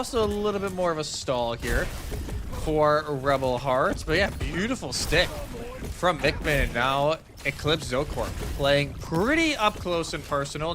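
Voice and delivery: usual speaking voice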